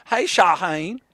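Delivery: high, loud southern greeting; southern accent. Acted. Host greeting Shaheen by name, southern accent. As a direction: hail him with a southern twang. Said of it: "anyone who has an accent like that".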